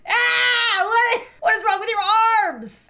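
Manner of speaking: high, loud horrified cry; shocked scream. Real, not acted. Mom horrified by the baby's arms, screaming. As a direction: shriek at the sight.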